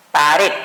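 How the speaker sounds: Pared with the final "red" stressed. Shown as a demonstration. Teacher modelling pared for students. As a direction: say it with the weight on "red". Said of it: "La palabra pared".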